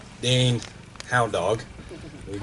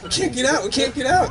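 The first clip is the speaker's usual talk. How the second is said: high, squealed imitation of trapped passengers; mock panic; 3 dB louder than usual. Back-seat hunters trapped by child locks, mock-panicked squeal.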